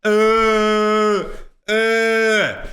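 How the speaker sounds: hammed-up dopey uh; dopey grunt. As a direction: sound like a slack-jawed gamer zoning out. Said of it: "with your soy jackass face going".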